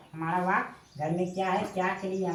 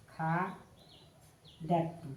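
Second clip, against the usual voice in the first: very slow, softer, split into syllables; slow dictation; 5 dB quieter, much slower than usual. Shown as a demonstration. Teacher writing the verb out for students, slow soft dictation. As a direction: draw it out syllable by syllable, softly.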